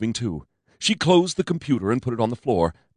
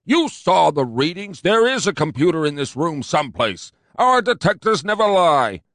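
higher, louder pompous cop; fat cop insisting; 8 semitones higher, 4 dB louder than usual. A narrator's reading. Fat cop insisting to his partner, pompous.

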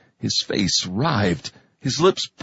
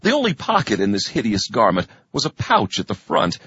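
plain reading voice

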